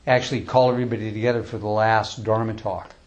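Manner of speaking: normal talking voice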